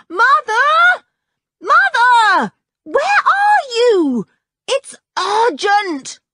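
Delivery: high, loud and drawn-out yell; a girl yelling for her mother, slow and loud; yelling at full voice. Acted. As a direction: bellow it at the top of your lungs. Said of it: "at the top of her very strong voice".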